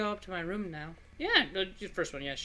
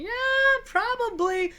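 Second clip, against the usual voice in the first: mocking laugh; 7 dB louder, much slower than usual. Mocking yes to girl.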